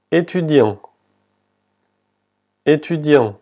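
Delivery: "Étudiant" opening on é like Spanish e; "Étudiant" ending on a nasal vowel, T silent; French é. Shown as a demonstration. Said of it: "se pronuncia como la E en español".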